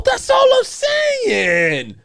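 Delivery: very high, slow, shouted "That's all I'm saying"; angry shout. Real, not acted. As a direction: yell it out, stretched and furious. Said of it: "we're getting a little angry".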